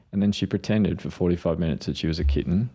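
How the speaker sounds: ordinary delivery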